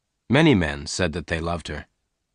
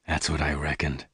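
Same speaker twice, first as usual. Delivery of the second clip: low and much softer; quietly; 5 semitones lower, 7 dB quieter than usual. A narrator's reading. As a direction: say it quietly to yourself. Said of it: "Lassiter said quietly".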